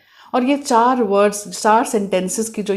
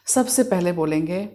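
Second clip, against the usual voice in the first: ordinary talk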